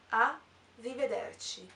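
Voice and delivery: arrivederci drawn out slowly, each syllable separate; slow Italian pronunciation. Shown as a demonstration. Teacher slowly repeating arrivederci for French learners. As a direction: let arrivederci unfold slowly for the class. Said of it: "au revoir".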